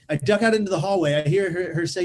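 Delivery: usual speaking voice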